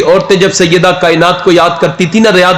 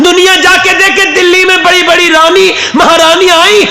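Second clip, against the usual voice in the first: preaching at full force; 11 semitones higher than usual. Acted. Preacher to the gathering, crying out at full force. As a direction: pour it out to the crowd as a rising wail. Preacher's climax about the shrine.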